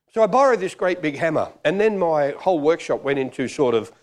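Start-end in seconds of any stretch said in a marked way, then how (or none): none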